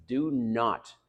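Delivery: ordinary talk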